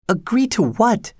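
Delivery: put-on high-pitched questioning girl character; puzzled girl. A narrator's reading. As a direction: ask back in a high, young woman's voice. Kathy questions Linton's strange plea.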